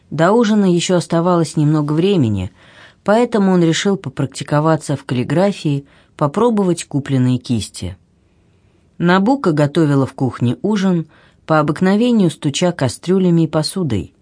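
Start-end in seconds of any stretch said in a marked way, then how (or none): none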